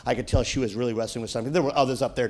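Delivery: ordinary talk, nothing marked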